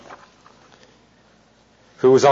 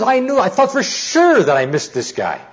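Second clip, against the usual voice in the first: high, baffled taxi driver voice, beside himself; voicing the stunned driver, pitched up in disbelief; bewildered German driver; 8 semitones higher than usual. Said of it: "the driver was beside himself".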